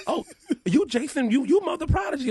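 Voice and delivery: high, laughing fan voice; gushing fan, half laughing; excited fan. Retold or imitated.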